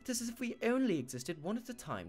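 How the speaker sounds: put-on stagey British accent with swooping pitch; learner's British accent for a play. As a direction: recite it in an effortful British accent. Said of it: "speak in a British accent".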